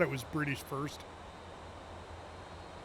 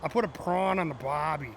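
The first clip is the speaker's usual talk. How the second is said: put-on lightly done Australian accent; 2 semitones lower than usual. Mimicking Australians for the chat, light Australian accent. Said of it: "They say prawn".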